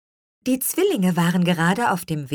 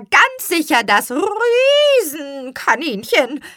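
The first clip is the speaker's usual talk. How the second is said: high, loud, drawn-out crow; slow, stretched chicken squawk; crowing hen; 12 semitones higher, 5 dB louder, slower than usual. A narrator's reading. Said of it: "krähte Anaconda".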